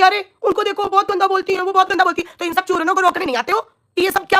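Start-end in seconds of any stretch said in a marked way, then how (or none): 0.0-2.4 higher, prissy mimicry of gossiping people; mock gossip voice. Mimicking people who gossip about her, mocking. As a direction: sneer it like a gossiping crowd. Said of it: "सब लोग बोलने लगेंगे कि".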